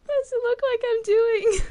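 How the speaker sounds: high, loud snapping back; angry retort. Acted. Allison to the lawyer while bashing her head, an angry shout. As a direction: bite back, furious at being questioned.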